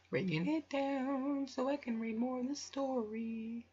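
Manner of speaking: high, drawn-out aside; high slow voice. Real, not acted. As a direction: stretch it out up high. Aside while reading.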